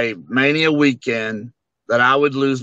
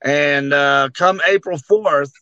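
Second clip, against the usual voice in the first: ordinary talk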